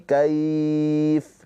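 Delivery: kaif with the final vowel dropped at a stop; pausal form. Shown as a demonstration. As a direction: stop on kaif, cutting the ending. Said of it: "Terkecuali di saat berhenti".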